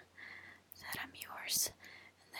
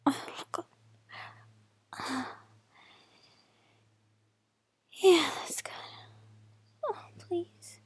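breathy moaning whisper; 4 semitones higher, 3 dB louder than usual. Real, not acted. Whispered moans, rising.

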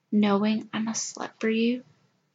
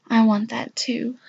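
whispering. Acted. Intimate whisper.

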